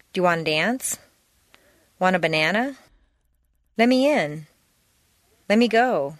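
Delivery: relaxed colloquial reductions, every sentence linked; casual reduced American speech. Shown as a demonstration. Teacher models reductions for learners to repeat, casual linked speech. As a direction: run the words together the way natives do, gotta, wanna, whatcha.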